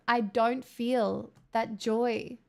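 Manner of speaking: usual speaking voice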